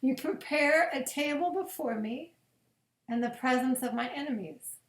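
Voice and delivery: slow, reverent psalm reading with long pauses. Acted. Pastor reading Psalm twenty-three at bedtime, slow and reverent. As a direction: let each verse land gently, slowly.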